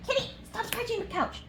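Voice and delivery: high, soft scold to a pet; scolding the cat. Real, not acted. Scolding the cat mid-video.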